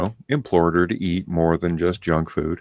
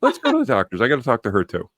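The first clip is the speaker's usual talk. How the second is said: indignant demand; 5 semitones higher, 5 dB louder, much faster than usual. Retold or imitated. Retold angry demand.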